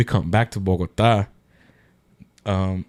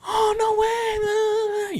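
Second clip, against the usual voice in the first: mock girl voice, much higher, drawn out, shouted; girl's amazed reply; much slower than usual. Guy imitates the girl at the bar, high and stretched. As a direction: play her amazed, slow and high.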